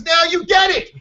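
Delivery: high-pitched, delighted exclamation; acted-out gleeful punchline; triumphant mock shout. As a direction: crow it out with the voice way up. Old man's punchline to the runner.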